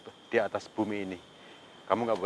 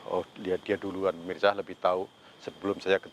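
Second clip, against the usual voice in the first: normal talking voice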